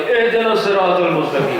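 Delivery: laughing mock recitation of the follower. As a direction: recite it out loud, laughing through it. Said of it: "آپ صاحب کی آواز آئی".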